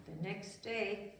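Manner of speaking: plain reading voice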